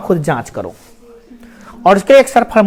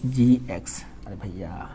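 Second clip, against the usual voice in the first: whisper; 13 semitones lower, 15 dB quieter than usual. Shown as a demonstration. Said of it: "whispered answer demo".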